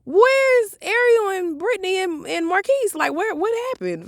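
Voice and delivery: searching for friends, worried; alarmed calling. Retold or imitated. Asking where her friends went.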